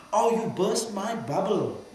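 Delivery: high, loud, drawn-out mock complaint; mock dismay. Master imitating a follower whose bubble he burst, mock dismay. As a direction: imitate a let-down devotee, slow and high. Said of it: "The master is here to burst your bubbles".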